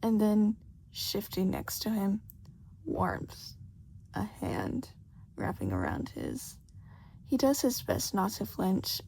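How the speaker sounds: ordinary delivery, nothing marked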